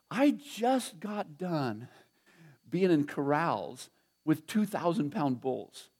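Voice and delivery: slow and steady, each phrase weighed; slow, deliberate reply. Retold or imitated. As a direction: let every word land, unhurried. Retelling his calm reply to bully.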